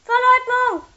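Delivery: high, very loud goblin outburst; growling. Acted. Furious goblins hammering away at their work, growling loudly. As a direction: growl it out furiously at full volume. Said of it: "knurrten die Kobolde".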